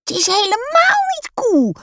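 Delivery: put-on very high, loud child's screech. A narrator's reading. Lotte contradicting papa about the cool word, screeching. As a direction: screech the objection in protest. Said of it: "krijst Lotte".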